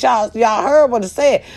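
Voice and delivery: normal talking voice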